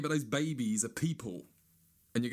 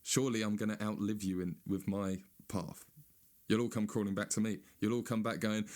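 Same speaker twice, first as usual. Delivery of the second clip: usual speaking voice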